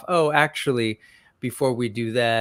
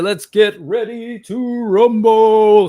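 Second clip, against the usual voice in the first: booming, slow fight announcer call; ring announcer; 10 semitones higher, 10 dB louder, slower than usual. Pretend. Host hyping the stream opening, booming announcer call.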